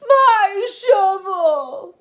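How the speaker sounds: loud, slow, drawn-out howl; bellowing. Acted. Peter howls for his lost shovel, loud drawn-out bellow. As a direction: howl it like a furious toddler. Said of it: "Peter bellowed".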